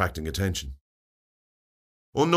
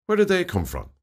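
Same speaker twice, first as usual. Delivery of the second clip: puzzled; 10 semitones higher than usual. A narrator's reading. Narrator voices puzzled inner questions.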